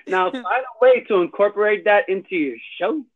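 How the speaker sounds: ordinary talk, nothing marked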